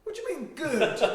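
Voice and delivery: high, laughing disbelief; a puzzled, giggling "what do you mean"; baffled. Retold or imitated. Puzzled reply to the bikers.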